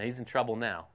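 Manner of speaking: ordinary talk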